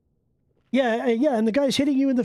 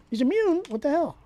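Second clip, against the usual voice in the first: "immune" jumps up high in disbelief; can't believe the mob resists it; surprised; 6 semitones higher than usual. Real, not acted. Gamer finds out the elemental is immune, stunned higher question.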